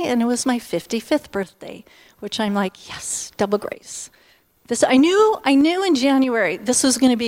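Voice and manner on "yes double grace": whispered joy. Retold or imitated. Delight at fifty-fifth birthday.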